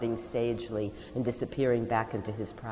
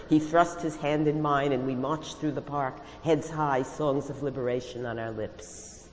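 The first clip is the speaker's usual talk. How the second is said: usual speaking voice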